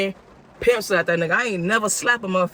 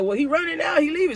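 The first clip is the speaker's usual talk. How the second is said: urgent; 9 semitones higher than usual. Retold or imitated. Urgent report to dispatcher.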